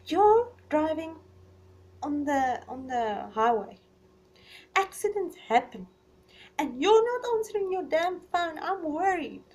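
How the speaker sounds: imitating a worried partner who raises his voice; worried boyfriend. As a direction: voice the partner fretting about her safety. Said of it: "And he's like".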